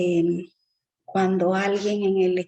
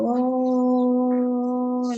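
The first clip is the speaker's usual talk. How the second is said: a long, higher, stretched "Oh" like a goal is coming; drawn-out oh; 4 semitones higher than usual. Shown as a demonstration. Teacher imitating fans seeing a goal: long rising oh. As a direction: stretch the sound as the crowd rises. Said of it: "Los alargamientos de sonido".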